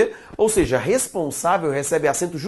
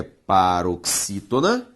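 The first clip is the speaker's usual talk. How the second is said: sounded out; 6 semitones lower, much slower than usual. Shown as a demonstration. Teacher sounds out key term.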